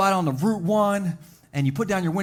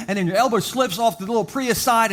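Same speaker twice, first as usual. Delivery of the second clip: ordinary delivery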